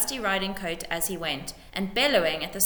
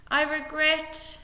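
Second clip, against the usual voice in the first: slow, high, grave; solemn regret; 8 semitones higher, slower than usual. A narrator's reading. Lord feigning regret to the widow, slow and grave. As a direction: feign sorrow.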